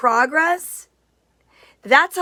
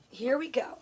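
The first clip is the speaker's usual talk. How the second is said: normal talking voice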